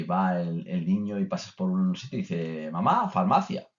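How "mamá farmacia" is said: put-on high, proud little child calling out; toddler voice. Small child proudly telling mum the sign. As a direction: chirp it like a two-year-old showing off.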